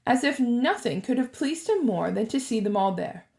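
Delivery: usual speaking voice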